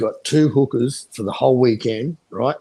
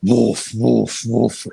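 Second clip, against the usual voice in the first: louder mock big-dog barks; big dog woofs; 4 dB louder, much slower than usual. Drunk client demands big dogs, louder mock barking. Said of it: "No, no, no, big dogs".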